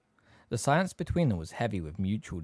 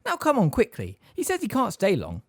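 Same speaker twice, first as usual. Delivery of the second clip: high, raised motherly voice; hurrying mother; 10 semitones higher, 5 dB louder than usual. A narrator's reading. Mother tells Harry the visitor can't stay long, hurrying him. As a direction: chivvy the child along.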